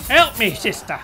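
calling for help. Pretend. Player calling the sister character for help, urgent shout. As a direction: call out urgently to a partner.

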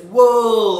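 "wool" loud, high and stretched; big drawn-out reveal; triumphant answer reveal. Shown as a demonstration. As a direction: slowly swoop up and call it out. Calling out the blended word.

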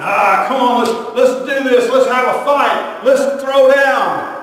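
high, loud taunting challenge, last line slow; eager challenger. Acted. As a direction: egg him on to a fight, loud and high. Satan eager to fight Michael.

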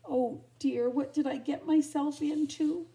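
high, loud wail of dismay; storybook heroine in distress; dismayed cry. A narrator's reading. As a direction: cry out in alarm at your own plight. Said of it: "she cried as the door slammed behind her".

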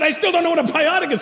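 put-on high, fast kid protest; rushed high-pitched complaint; frustrated child. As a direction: imitate a confused son blurting back. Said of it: "I said".

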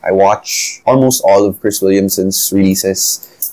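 ordinary talk

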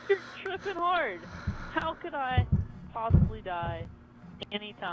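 high, loud mock disbelief; incredulous scoffing. Real, not acted. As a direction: squeal it in exaggerated disbelief. Mock disbelief at friend's death wish.

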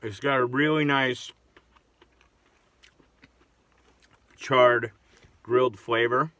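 speaking between bites of burger; talking while chewing. Real, not acted. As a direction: mouth partly full of food. Seen 0: Holds burger throughout; bites at -9 and 17.4, mouth working between.

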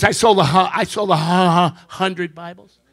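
a job seeker stammering out his answer; stuttering. Acted. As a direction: stammer on the A before absolutely. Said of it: "a speech impediment".

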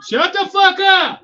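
sudden high, loud shout; angry yell. Real, not acted. Breaking off own talk to silence someone, angry yell. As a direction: yell it off mic to silence them.

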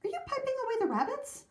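high, quick questioning voice; curious Amanda. A narrator's reading. Amanda questioning the piper, high curious voice. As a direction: ask eagerly, pitched well up.

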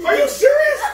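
high laughing shout; cracking up, can't believe it; laughing disbelief. Real, not acted. Shocked laughing mother.

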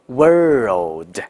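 WORLD drawn out, curled r then dark L. Shown as a demonstration. Teacher tackles a hard word for learners, drawn-out r and L. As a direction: curl the tongue in WORLD, then press it up for L. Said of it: "word卷舌，然后再抵两下".

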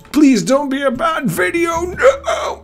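squeaky falsetto plea; panicked high-pitched begging; high pleading squeal. Pretend.

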